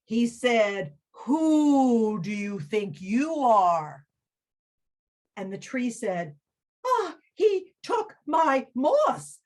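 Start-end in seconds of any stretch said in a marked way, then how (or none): 1.2-4.1 slow, hooting owl. Acted. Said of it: "you know how owls talk". 6.9-9.4 much higher, slow complaining voice; tree tattling to the owl; whiny tree. Acted.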